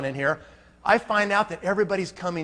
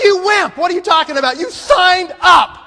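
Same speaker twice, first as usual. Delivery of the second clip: high, loud shout of disbelief; exasperated yell; 12 semitones higher, 8 dB louder than usual. Retold or imitated. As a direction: blow up at the friend who chickened out. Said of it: "I was like".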